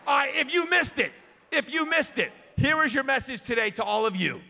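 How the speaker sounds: ordinary talk, nothing marked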